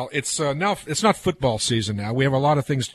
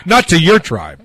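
laughing through the joke, a lot louder; 5 semitones higher, 10 dB louder, slower than usual. Real, not acted. Teasing a friend about his tribe, laughing. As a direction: laugh out the punchline.

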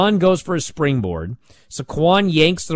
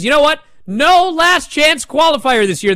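high, loud mock announcement; hammed-up Ava declaring no qualifier; Ava announcing; 11 semitones higher, 5 dB louder than usual. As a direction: announce it like a bossy GM. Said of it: "Ava says".

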